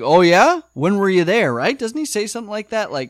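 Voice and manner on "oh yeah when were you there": raised, louder mock surprise; a lightly voiced curious Bull, pitched up; quoting Bull. Bull asks Jeremy when he was at the museum, mock surprised. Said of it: "and he's like".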